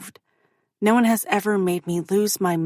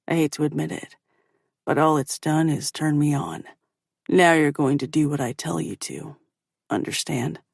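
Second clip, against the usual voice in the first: low male voice; 4 semitones lower than usual. A narrator's reading. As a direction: lower commanding male voice.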